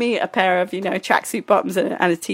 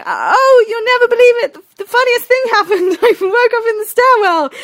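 very high, loud breezy gushing; false cheeriness; 6 dB louder than usual. Retold or imitated. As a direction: re-enact making light of it in a squeal. Falsely cheerful excuse to angry date.

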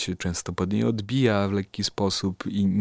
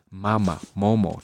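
normal talking voice

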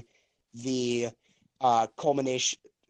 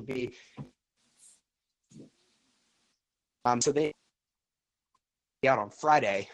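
cutting out. Real, not acted. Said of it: "you're cutting out on me".

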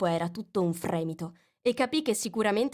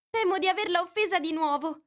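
Alice voice; 11 semitones higher, 3 dB louder than usual. A narrator's reading. Alice puzzles over the mouse. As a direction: wonder to yourself.

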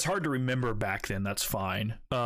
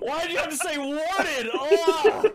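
high, loud laughing rant; laughing hard; 6 dB louder than usual. Real, not acted. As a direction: shout it through helpless laughter. Laughing after spit-take.

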